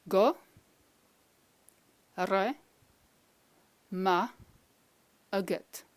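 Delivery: "Go, re, ma, agat" one piece at a time; long pauses between Go, re, ma and agat; slow, separate sounds. Shown as a demonstration. Teacher giving individual sounds to repeat, slow and separated. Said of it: "I say it slowly a couple of times".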